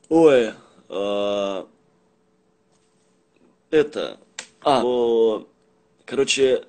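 ordinary talk, nothing marked

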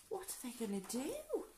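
ordinary delivery, nothing marked

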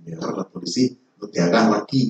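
breathy whisper, barely voiced; whispered in the ear. Gang member whispering in the pastor's ear, hushed. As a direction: lean in and whisper a secret plea. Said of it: "al oído me habló".

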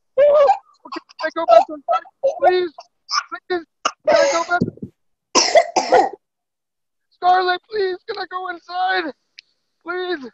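very high, pleading whine to go back in; desperate begging. Real, not acted. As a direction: beg Scarlett, voice cracking way up. Begging daughter to go inside.

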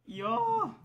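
very high, loud cheer; excited cheer. Real, not acted. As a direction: squeal with joy. Cheers in game. (hohes, lautes Jubeln)

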